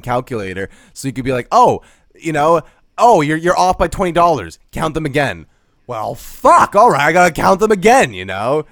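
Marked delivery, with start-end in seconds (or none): 1.5-5.5 hammed-up bossy supervisor telling her to recount; mock bossy manager. Mimicking boss making her recount. 5.9-8.1 loud, higher frustrated groan of a line; exasperated cashier forced to recount; mock exasperated outburst.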